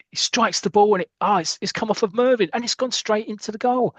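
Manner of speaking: high-pitched live play-by-play; excited match commentary. Acted. Podcaster retelling the free kick as it happens, excited commentary. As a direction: commentate as the goal unfolds, pitch held high.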